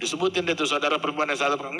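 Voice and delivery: ordinary talk, nothing marked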